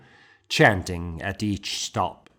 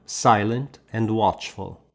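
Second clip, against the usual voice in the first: plain reading voice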